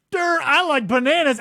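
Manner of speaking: hammed-up dumb guy; dopey voice. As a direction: play a clueless dope.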